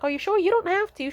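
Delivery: high-pitched imitation of polite hesitation; polite checking. Daughter checking mom really wants to help, high polite imitation. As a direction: gently protest an offer in a high voice.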